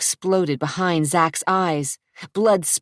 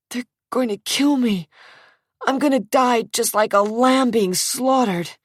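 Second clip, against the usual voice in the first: terrified thought; 7 semitones higher than usual. Acted. Zach thinks he'll die.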